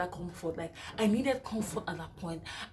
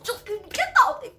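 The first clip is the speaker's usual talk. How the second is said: get out shouted; 12 semitones higher, 8 dB louder, much slower than usual. Retold or imitated. Telling husband to leave.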